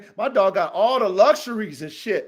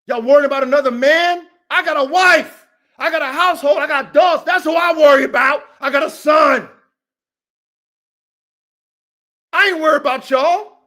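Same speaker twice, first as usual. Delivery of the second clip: loud, higher, heated yelling; angry shout; 7 semitones higher, 7 dB louder than usual. Real, not acted. Breeder yelling at naysayers about his family, angry. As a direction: shout down everyone doubting you. Ranting at online critics.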